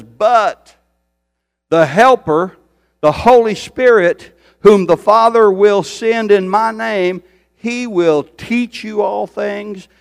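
loud, high-pitched preaching climb; rising revival preacher cry; full-force preaching. Acted. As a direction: preach it out to the back row. Preacher proclaiming the Holy Spirit.

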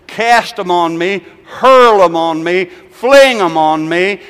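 loud, slow commands; calling out. Retold or imitated. God calling his people, slow and loud. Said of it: "He said".

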